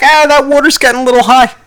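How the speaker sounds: very high, loud squeal of worry; hammed-up nervous yelp; mock alarm. Driver in traffic watching flood water rise, high-pitched mock panic. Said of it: "you're just like".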